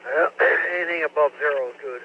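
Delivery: ordinary talk, nothing marked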